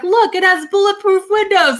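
hammed-up product launch brag; boastful presenter. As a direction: show off the windows like a proud launch host. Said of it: "they're like".

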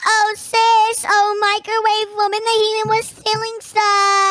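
put-on high-pitched girly villain voice; high character voice. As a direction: play a bratty high-voiced sister. Microwave sister character voice.

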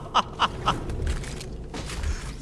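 soft airy laugh after a scare; breathy laugh. Real, not acted. Streamer laughing off a jump scare, breathy laugh. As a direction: let the fright out as a laugh.

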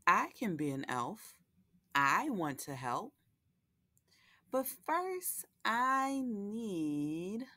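lively picture-book read-aloud with character voices; reading to children. A narrator's reading. Host reading Lola the Elf to young viewers, storytelling voice. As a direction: read it like a bedtime story for little ones.

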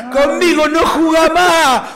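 high, loud, drawn-out shout; coach yelling. Retold or imitated. Coach banishing Caruso from the bench, drawn-out yell. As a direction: holler it slowly across the pitch. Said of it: "me gritaba".

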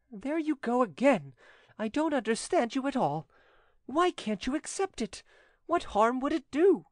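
high-pitched, protesting woman; exasperated widow. Acted. Widow urges notary to take her loan, high exasperation. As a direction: press him in a high, impatient voice. Widow presses him to accept.

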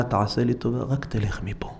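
hushed brush-off, dropping to a whisper; tired whisper sending him off; weary whisper. Retold or imitated. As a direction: wave him away under your breath. Cashier dismisses him quietly.

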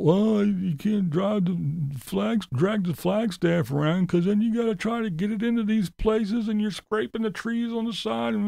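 whiny parody of his excuses; mocking the trailer excuse; mocking whine. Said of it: "you know, the whole".

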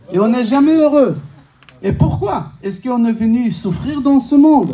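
ordinary talk, nothing marked

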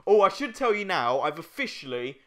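ordinary talk, nothing marked

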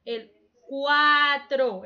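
cuatro said slowly, vowels together; slow joined syllables. Shown as a demonstration. Teacher to class, showing cuatro slowly. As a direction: stretch cuatro as two beats. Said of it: "se escucha pegadito".

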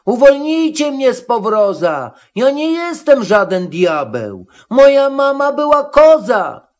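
high, pleading goat voice; high-pitched appeal to be freed; Matołek calling out. A narrator's reading. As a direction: protest high, rising to a squeal at the end. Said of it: "kozioł woła".

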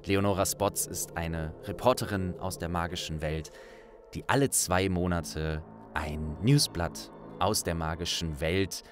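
usual speaking voice

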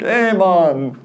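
bad, high, loud Jamaican accent; hammed-up Jamaican accent, self-mocking. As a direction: toss off a cheesy Jamaican greeting. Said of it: "I have a horrible Jamaican accent".

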